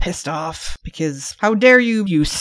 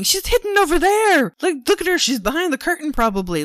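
much higher, frantic mock voice; paranoid Dmitri; 9 semitones higher, 4 dB louder than usual. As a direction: point wildly, convinced she is hiding. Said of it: "He's pointing at places going".

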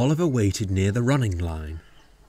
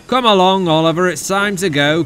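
loud, raised driver's call; high, hearty summons; 9 semitones higher, 8 dB louder, faster than usual. A narrator's reading. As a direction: call to him across the yard. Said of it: "called his driver".

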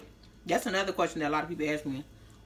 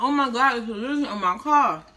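retold panic; 3 semitones higher, 7 dB louder than usual. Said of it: "I was like".

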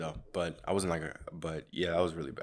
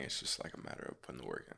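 2 whispered, trailing off; 3 drop to a fast whisper; 4 quietly whispered afterthought; 11 semitones lower, 12 dB quieter than usual. Real, not acted.

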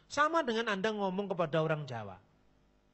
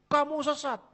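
mock accusation; 9 semitones higher, 3 dB louder than usual. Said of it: "Anda katakan".

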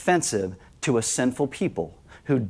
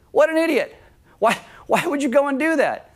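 much higher, far-off scoffing voice; incredulous scorn; 11 semitones higher than usual. Retold or imitated. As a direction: scoff at a senseless act in disbelief. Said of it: "You say".

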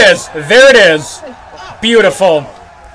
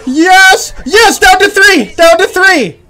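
high, loud, joyful yells; ecstatic cheering; 8 semitones higher, 3 dB louder, much slower than usual. Real, not acted. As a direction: cheer like your team just won. Celebrating tower catch.

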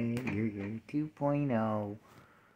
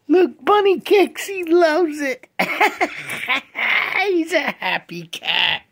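much higher and louder, laughing at the end; delighted squeal; 12 dB louder, slower than usual. Real, not acted. Owner squealing as the cat kicks happily.

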